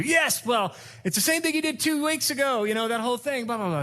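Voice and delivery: high mock wife nagging; high-pitched mock scolding wife. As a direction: play the wife rehashing old faults. Wife voiced in quarrel.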